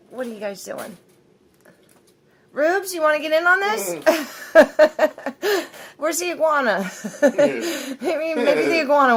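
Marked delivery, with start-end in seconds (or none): 2.5-6.0 slow, higher laughing question. Real, not acted. Laughing at kissing pets. 6.0-9.2 laughing. Real, not acted. Laughing while filming pets.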